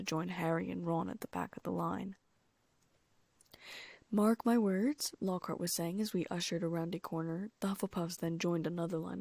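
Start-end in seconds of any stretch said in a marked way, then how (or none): 4.1-5.1 slow, grand, self-important drawl; pompous teacher voice. Acted. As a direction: lay down the law like a vain professor. Lockhart pompous pronouncement.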